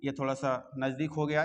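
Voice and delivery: ordinary talk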